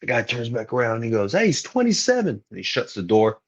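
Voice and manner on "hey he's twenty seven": calling out. Retold or imitated. Doorman calling out to room.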